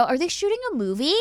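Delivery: put-on ditzy girlish voice; dumb naive girl. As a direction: play the clueless teen, rising. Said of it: "And I go".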